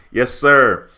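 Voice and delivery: ordinary talk, nothing marked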